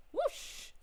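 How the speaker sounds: whispered whoosh. Acted. Sound of the star flying away.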